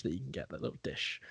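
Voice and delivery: whispered aside. Real, not acted. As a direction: drop to a whisper. Whispered aside about dish.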